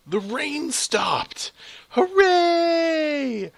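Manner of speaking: high, drawn-out celebration; jubilant cheer. Real, not acted. Player cheering the rain stopping, high jubilant shout. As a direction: build to a loud, very high hooray.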